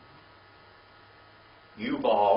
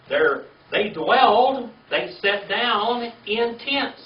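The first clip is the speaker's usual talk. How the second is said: slow, emphatic and raised: "dwelled" stretched out high and loud; teacher drilling the word "dwelled"; stressed dwelled; 8 semitones higher, 8 dB louder, slower than usual. Shown as a demonstration. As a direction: lean hard on "dwelled", slowly. Teacher stressing key word slowly.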